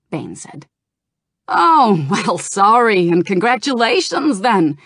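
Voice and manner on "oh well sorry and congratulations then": high, loud goblin with a laugh. A narrator's reading. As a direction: chuckle it out as the goblin. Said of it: "Gallywix laughed".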